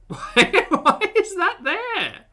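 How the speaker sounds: high, drawn-out disbelief; shocked. Real, not acted. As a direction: stretch it out, baffled. Shocked at the box.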